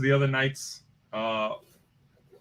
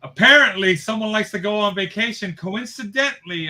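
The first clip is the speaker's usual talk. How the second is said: pointed accusation; 6 semitones higher, 7 dB louder than usual. Real, not acted. Accusing partner jokingly.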